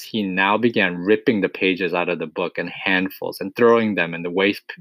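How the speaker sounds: ordinary delivery, nothing marked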